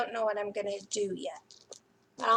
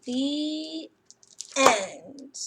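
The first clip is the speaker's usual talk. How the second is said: put-on lively storybook reading to children; picture book reading; 3 dB louder than usual. A narrator's reading. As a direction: read it like a bedtime story for little ones.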